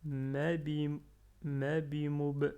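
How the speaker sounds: ma bi mo b, le b final avec soukoun bien sorti; lecture appliquée. Shown as a demonstration. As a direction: ouvrir la bouche pour faire sortir le b final. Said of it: "à ouvrir la bouche à la fin pour sortir bien le b avec soukoun". (b final soufflé en fin de mot)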